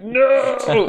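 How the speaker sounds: a high, loud pretend cry of dismay; mock despair. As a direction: wail "No!" as the hoped-for boost fizzles. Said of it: "and you'll be like".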